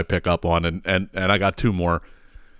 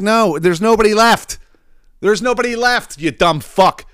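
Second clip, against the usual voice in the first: exasperated retort; 10 semitones higher than usual. Retold or imitated.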